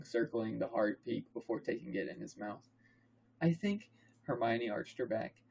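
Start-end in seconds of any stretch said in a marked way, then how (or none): 3.4-3.9 higher female character voice. A narrator's reading. Narrator as Hermione answering Harry, higher voice. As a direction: pitch it up for the girl.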